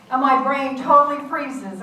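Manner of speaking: usual speaking voice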